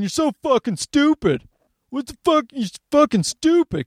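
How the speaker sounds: half-hearted mock rant at the other side; mock flipping out. Comic imitating someone ranting at opponents, mock angry. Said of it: "flipping out at 'em going".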